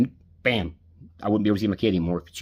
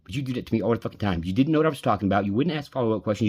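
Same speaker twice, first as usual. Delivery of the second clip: usual speaking voice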